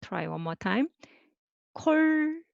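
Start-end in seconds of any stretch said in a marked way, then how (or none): 1.7-2.4 콜록 very high, first syllable held, then a pause. Shown as a demonstration. Last slow repeat for writing.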